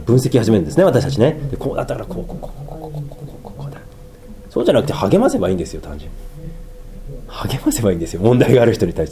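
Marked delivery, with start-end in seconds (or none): 1.6-3.8 早口のひそひそ声で分析を真似る; whispered analysis. Retold or imitated. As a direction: mutter it fast under the breath like a busybody. 分析する人を真似る早口のささやき. (ひそひそ早口でぶつぶつ分析する)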